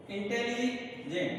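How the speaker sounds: इंटेलिजेंट slowly, with a break before जेंट; split into syllables. Shown as a demonstration. Teacher pointing out double syllables, इंटेलिजेंट said slowly in two parts. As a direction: separate इंटेली from जेंट, unhurried. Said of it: "double syllable आ रहे हैं".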